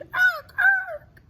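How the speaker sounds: very high, loud bird shriek; jay screech. Acted. Reading the jays' scream aloud, high loud shriek. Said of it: "The jays screamed".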